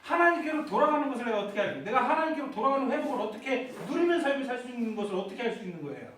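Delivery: usual speaking voice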